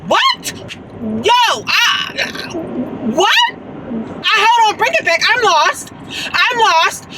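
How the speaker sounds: very high, squeaked "what" and baffled protest; high-pitched, theatrical confusion; shocked disbelief. Retold or imitated. Retelling shock at boyfriend questioning caller.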